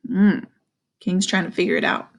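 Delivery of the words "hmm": usual speaking voice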